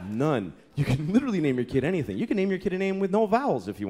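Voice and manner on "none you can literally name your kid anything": ordinary delivery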